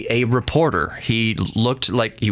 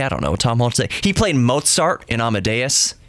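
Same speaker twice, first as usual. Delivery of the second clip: usual speaking voice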